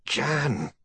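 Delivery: high, horrified gasp of a name. A narrator's reading. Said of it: "said in a horrified tone".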